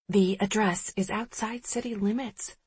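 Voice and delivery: soft, hushed report; whispering. A narrator's reading. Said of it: "Sterling whispers".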